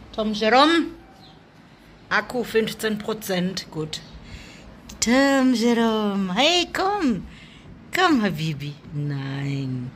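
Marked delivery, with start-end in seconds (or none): 0.1-0.9 higher call with a rising end; cooing call. Real, not acted. Owner coaxing her shy cat, high with a rising end. 5.0-8.7 very slow, falling call, then a high hey; slow coaxing call. Real, not acted. Owner coaxing her shy cat, slow and drawn out, then high.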